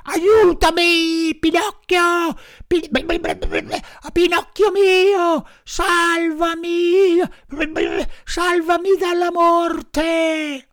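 high, slow, desperate pleading of a drowning dog; high, slow begging cry; heartrending dog's plea. A narrator's reading. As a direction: beg for your life, drawn out and high. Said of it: "A quelle grida strazianti".

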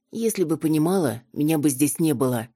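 ordinary delivery, nothing marked

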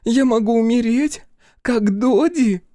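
high, slow whimpering fear; whiny tattling little sibling. Acted. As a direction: whine it like a frightened child. Fiona keeps whining in fear.

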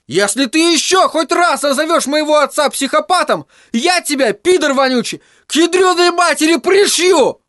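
высокий яростный мальчишеский крик; угрожай, не помня себя; в бешенстве. Acted. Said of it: "не помня себя от бешенства". (Тедди в бешенстве грозит Майло)

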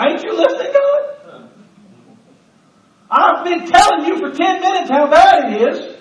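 high, laughing exasperation at God; exasperated complaint. Acted. Believer complaining to God, high exasperated whine. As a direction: whine at God like he is not listening.

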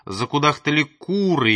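plain reading voice

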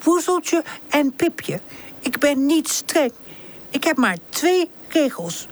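high, slow, mock-strict aunt; silly aunt voice. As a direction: put on a silly bossy auntie voice. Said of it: "Pip zet een gek stemmetje op".

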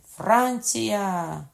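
loud, high, drawn-out battle cry; shouting. A narrator's reading. Rider gallops into the yard, shouting. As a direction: call it out while galloping in. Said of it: "кричачи".

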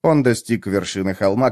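ordinary delivery

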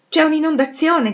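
high, loud startled cry; startled shout. Acted. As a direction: bellow in sudden alarm. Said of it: "gridò".

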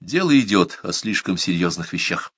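ordinary delivery, nothing marked

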